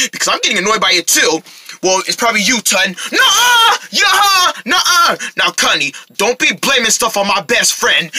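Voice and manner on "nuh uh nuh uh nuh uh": high, whiny back-and-forth contradiction; childish squabbling. Acted. Friends arguing over who makes the noise, childish squabbling. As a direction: squabble like bickering kids.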